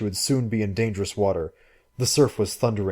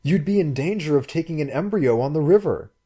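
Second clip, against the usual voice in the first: alarmed; 7 semitones higher, 3 dB louder than usual. Acted. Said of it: "he cried in alarm".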